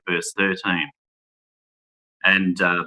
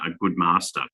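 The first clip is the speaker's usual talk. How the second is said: usual speaking voice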